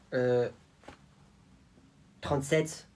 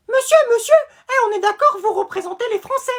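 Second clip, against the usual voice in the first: put-on high, eager childish questioning; eager kid voice; 8 semitones higher than usual. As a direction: pipe up excitedly to the president. Kid asks president a question.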